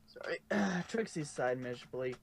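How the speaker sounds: plain reading voice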